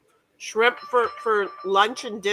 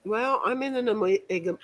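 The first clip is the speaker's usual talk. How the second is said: ordinary talk, nothing marked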